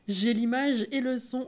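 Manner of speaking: normal talking voice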